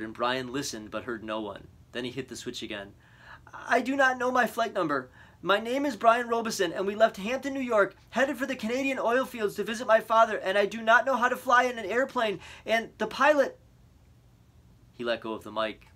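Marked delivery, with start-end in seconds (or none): 3.5-13.6 high, loud strained plea; rattled voice. A narrator's reading. Boy repeating his details into the dead radio, strained and loud. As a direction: hold it together, barely. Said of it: "His voice was starting to rattle".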